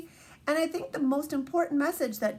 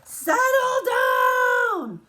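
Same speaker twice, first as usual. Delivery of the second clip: long drawn-out high shout, much louder; mom shouting; 14 semitones higher, 7 dB louder, much slower than usual. Acted. Teacher reading the mom who yells at jumping David, drawn-out shout. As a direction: yell it stretched out like a fed-up mother.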